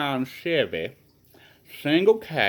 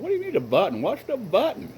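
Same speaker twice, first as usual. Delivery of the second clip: higher, puzzled questioning; puzzled wife; 8 semitones higher than usual. Retold or imitated. Wife to husband asking for the button, higher puzzled voice. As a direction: ask it baffled. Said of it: "They're all asking".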